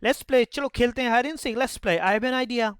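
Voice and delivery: put-on high, slow, excited kid; eager child. Child excitedly pitching a game to friends.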